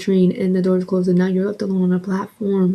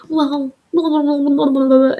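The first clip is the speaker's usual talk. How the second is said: higher-pitched dismissive imitation of boyfriend; mocking blather; 6 semitones higher, 3 dB louder than usual. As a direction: rattle off his talk as meaningless noise.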